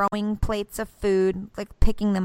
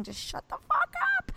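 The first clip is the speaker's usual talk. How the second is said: ordinary talk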